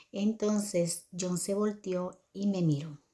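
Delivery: ordinary delivery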